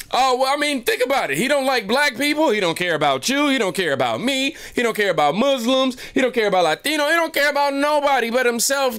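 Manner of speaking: high-pitched heated rant; emotional rant. Retold or imitated. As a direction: rant it out, all worked up. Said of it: "a very emotional rant".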